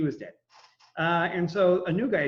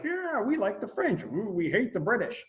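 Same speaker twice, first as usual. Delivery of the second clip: mock eager Americans; 6 semitones higher than usual. Teacher imitating pro-French Americans, hammed-up excitement. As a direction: cheer like a naive crowd.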